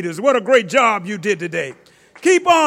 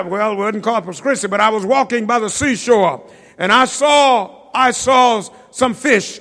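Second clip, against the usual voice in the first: ordinary talk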